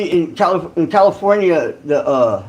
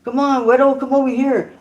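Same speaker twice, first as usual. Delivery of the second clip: higher, insistent come-here call; imitating a Mexican inmate calling out, pitched up; beckoning call; 7 semitones higher than usual. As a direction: wave him over like a big yard guy. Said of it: "the biceps would be".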